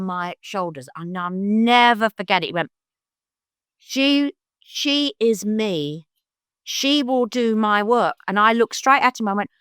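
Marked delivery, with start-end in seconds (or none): 3.8-8.2 slow, weighty prophecy; imitating a man pronouncing someone's destiny; solemn pronouncement. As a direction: declare it slowly, spacing out the words. Retold prophecy by little old man.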